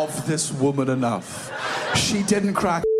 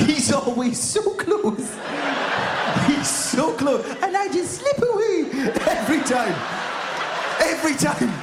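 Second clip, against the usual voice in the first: high, squeaky laughing sympathy; high-pitched amused lament; mock pity; 9 semitones higher than usual. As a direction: squeak it out as if pitying him. Mock pity for the failed culprit.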